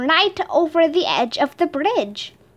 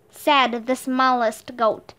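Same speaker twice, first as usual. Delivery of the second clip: ordinary delivery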